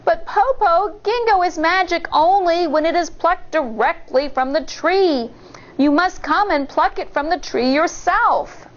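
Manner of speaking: high, lightly voiced clever girl; child's voice. A narrator's reading. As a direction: sweetly coax the wolf to climb. Shang tricking the wolf.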